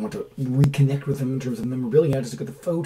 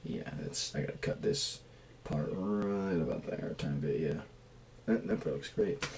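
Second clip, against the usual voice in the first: whispered and softer while focused; whispered muttering; 6 semitones lower, 11 dB quieter than usual. Real, not acted. Cutting his hair, whispering to himself in concentration. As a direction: mutter to yourself under your breath.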